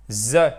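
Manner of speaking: "The" said as an isolated buzzed "zzuh"; final plural sound. Shown as a demonstration. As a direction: voice a loud "z" sound for "The". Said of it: "Faut bien prononcer le the à la fin".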